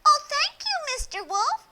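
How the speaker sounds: put-on a happy girl chattering to a stranger; cheerful little girl. Little girl tells the wolf where grandmother lives. Red's voice.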